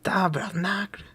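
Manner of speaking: put-on a high school teacher from rural Quebec cursing, in a thick Québécois accent; imitating rural Quebec teacher. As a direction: do the old country teacher's curse. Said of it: "Il parlait comme ça".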